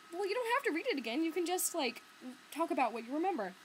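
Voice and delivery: high, quick retort; retold persuading. Patron talking librarian round about rereading, high persuasive retort. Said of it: "And I was like".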